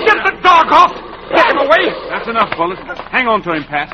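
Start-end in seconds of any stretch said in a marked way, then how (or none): 0.0-1.9 panicked yell. Acted. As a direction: shout for help. Dog attacking villain.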